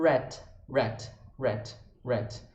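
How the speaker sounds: "Rat" with the r flowing straight into the vowel; smooth rat. Shown as a demonstration. Teacher showing the blended rat. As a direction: run the r right into "Rat". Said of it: "já emenda direto".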